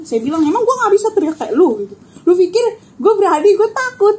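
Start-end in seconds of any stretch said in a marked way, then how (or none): 0.4-1.9 raised, higher challenging voice; mock yell thrown back in challenge; retold yelling back. Challenging the screaming apparition, mock yell back. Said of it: "Saya ikut teriak balik". 2.3-4.2 high, loud protest; retold yelling back. Yelling back at the apparition in fright, acted high shout. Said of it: "Dia teriak, saya teriakin lagi".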